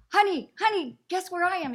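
high, loud thrilled honey; bursting with news to husband; excited call. Retold or imitated. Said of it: "I go".

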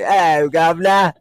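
loud, drawn-out hammed-up British greeting; mock British. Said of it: "They all say".

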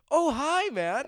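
put-on little kid voice. Toddler greeting a naked stranger in the stall, high cheerful kid. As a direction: chirp it like a friendly three-year-old. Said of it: "he's like".

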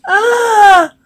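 high, small scream; mock scream.

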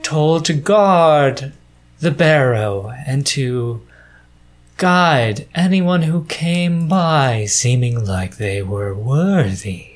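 drawn-out gargoyle voice with long pauses; lilting, measured stone guardian. Acted. Game master introduces the gargoyle and compatriot, measured character voice.

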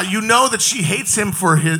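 ordinary talk

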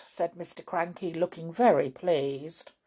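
ordinary delivery

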